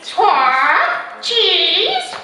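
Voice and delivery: high, loud parrot squawk; piercing bird mimicry; copying parrot. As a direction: squawk the word back like Polly. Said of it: "Polly Parrot copied".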